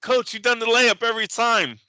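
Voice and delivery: high mock player voice; player to coach. Acted player answering the coach.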